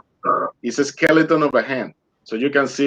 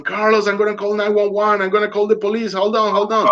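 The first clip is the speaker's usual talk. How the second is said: friend shouting from hill; 8 semitones higher than usual. Retold or imitated. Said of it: "he started jumping and saying".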